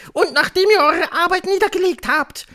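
very high, loud witch voice; commanding witch. A narrator's reading. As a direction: carry on ordering in a screech. Witch commanding her followers. (herrische Hexe, hoch und laut)

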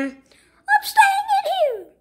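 very high, drawn-out whine; stubborn squeaky Iggy. Acted. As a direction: dig in with a slow, squeaky refusal. Iggy digs in.

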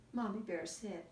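ordinary delivery, nothing marked

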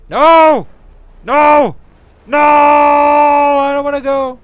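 high desperate screams; mock screaming. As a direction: wail it like being dragged away. Dragged up, pleading.

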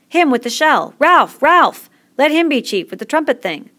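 high, loud crowd shouts; rousing clamour, high and loud; excited acclaim. A narrator's reading. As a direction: cry out for your chosen leader. Boys acclaim Ralph as chief.

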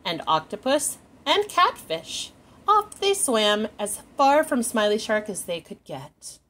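lively picture-book read-aloud; animated storytime narration; reading to children. A narrator's reading. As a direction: read it like storytime for little ones.

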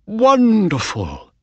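high, slow delighted wonderful; overjoyed owner, high and slow. A narrator's reading. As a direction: savour the win, pitch up and drawn out. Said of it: "said Mister Ross".

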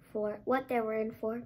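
usual speaking voice

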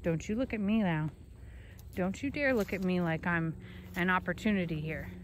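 usual speaking voice

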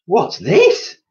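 high, loud shocked question; stunned as he walks in; shocked gasp. A narrator's reading. Mister Twit entering the living room, high shocked question. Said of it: "gasped Mister Twit".

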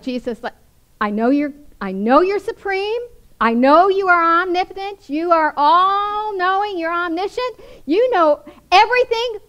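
a hammed-up know-it-all pleading with Jesus; Martha in us. The Martha in us lecturing Jesus, hammed-up insistence. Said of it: "The Martha in us goes like".

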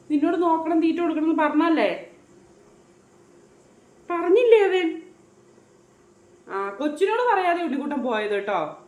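put-on high, sing-song motherly chatter to a baby; baby talk. Mother chatting to her baby about the dead fish, high sing-song baby talk. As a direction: coo at the little one like a doting mom.

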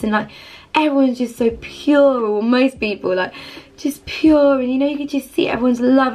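ordinary delivery

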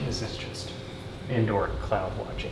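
hushed, very quiet; whisper. Pretend. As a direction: whisper it low from inside. Whispered inside wall.

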